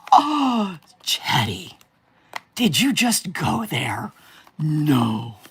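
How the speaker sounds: drawn-out mock outrage; mock offended. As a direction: pretend to be scandalised by the joke.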